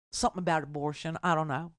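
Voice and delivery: low, quick, soft country mumbler; mock a clueless voter with a country accent. Host imitating voters asked why, country voice. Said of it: "do my country voice again".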